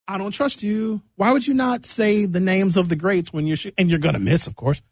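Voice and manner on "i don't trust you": normal talking voice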